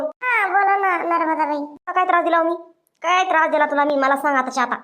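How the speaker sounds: normal talking voice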